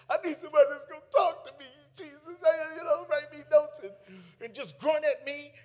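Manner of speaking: loud, high, fast venting; exasperated plea. Retold or imitated. Employee venting to Jesus about a supervisor, exasperated plea.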